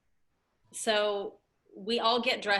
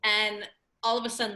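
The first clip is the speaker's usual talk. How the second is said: normal talking voice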